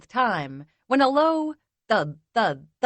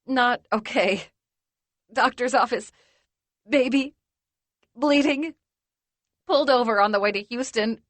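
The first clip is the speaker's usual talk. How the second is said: choked words; 3 semitones higher than usual. Acted. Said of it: "only squeeze out a few words at a time".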